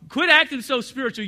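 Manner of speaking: mock-scold the congregation; teasing rebuke. Real, not acted. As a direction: poke fun at the pious ones.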